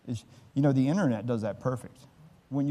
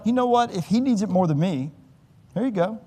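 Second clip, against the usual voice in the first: converted robber; 7 semitones higher, 5 dB louder than usual. Retold or imitated. Robber gives up, retold.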